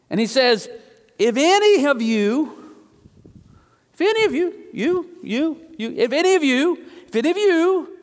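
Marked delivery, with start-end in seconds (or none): 1.2-8.0 high, repeated appeal to one after another; acted-out address, picking out each listener; pointed invitation. Jesus singling out the crowd, high and emphatic.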